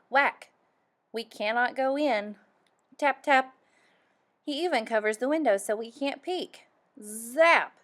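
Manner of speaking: plain reading voice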